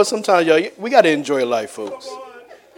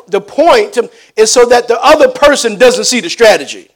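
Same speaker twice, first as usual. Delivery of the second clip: raised preacher's voice, louder; preaching; 6 semitones higher, 7 dB louder than usual. Acted. Preacher explaining chess strategy to congregation, raised and emphatic. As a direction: drive the point home from the pulpit.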